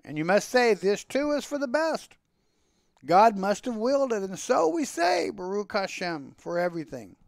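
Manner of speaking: high, insistent plea to God; chanting storyteller's prayer, pitched high; fervent prayer. A narrator's reading. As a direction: pray aloud with rising, lilting phrases. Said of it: "he's praying, he's talking to God".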